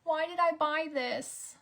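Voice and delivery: high whiny imitation again; milder hammed-up whimper; mock crying lady. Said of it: "This lady's like".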